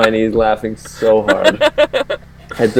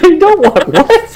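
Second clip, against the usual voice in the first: high, slow, laughing mock yell; mock mom yell; 4 dB louder, slower than usual. Buddy's mom answering the picky guest, high laughing mock yell. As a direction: call back in a high mom imitation, breaking into laughter. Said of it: "She's on top of the".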